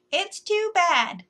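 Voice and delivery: high, loud and slow tree; an old tree lamenting. A narrator's reading. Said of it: "the tree said".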